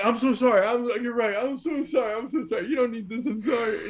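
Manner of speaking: high-pitched, babbling mock apology; panicked apology. Rookie salesman apologizes to angry customer, panicked and high. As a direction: imitate a flustered kid grovelling.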